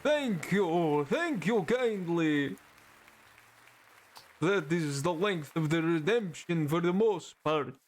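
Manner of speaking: stretched, mock Transylvanian accent; hammed-up Count-style accent; put-on Viking accent. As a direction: carry on in the botched Viking-vampire accent. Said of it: "I will just have to get to that secondary accent".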